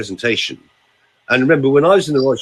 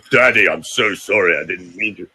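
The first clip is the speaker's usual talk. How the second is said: a low, heavy apology to daddy; low child's plea; 6 semitones lower than usual. Retold or imitated. Coach showing the low way a child never pleads. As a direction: say it low, with no whine. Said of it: "We don't go".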